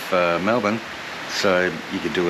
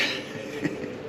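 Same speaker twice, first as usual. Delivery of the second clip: breathy laugh; 11 dB quieter than usual. Real, not acted. Laughs at own stumble.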